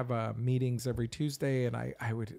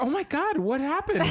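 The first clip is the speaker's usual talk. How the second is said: much higher, louder startled cry; mock alarm; 13 semitones higher, 4 dB louder than usual. Husband reacting to wife's gasp, high mock alarm. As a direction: act out sudden alarm.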